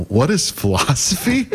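dim, puzzled student voice; clueless. Retold or imitated. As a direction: play your younger self, totally lost. Playing his clueless student self.

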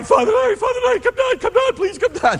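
high, pleading cry for the priest to come down; imitating crying plea. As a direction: wail it like a scared kid begging. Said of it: "And he's crying".

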